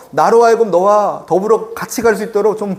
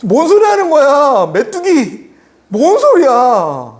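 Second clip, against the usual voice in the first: acting out an indignant Joshua and Caleb; indignant rebuke; 6 semitones higher, much slower than usual. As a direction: challenge them in acted outrage, stretching the last words. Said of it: "여호수아 갈렙이 뭐라고 얘기하고 있어요?".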